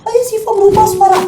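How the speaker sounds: very high sobbing scream for father; shrill tearful plea, rushed; terrified crying call. Retold or imitated. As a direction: cry out in panic. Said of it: "gue teriak lagi, gue manggil ayah".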